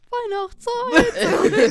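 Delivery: high, loud, very slow call; drawn-out high shout; stretched jingle. Pretend. Mock jingle again.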